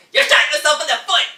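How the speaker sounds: high, loud burst; exclaimed punchline. Acted. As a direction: shout the punchline and cap it with a laugh. Cop's punchline in the play.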